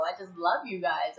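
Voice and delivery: ordinary delivery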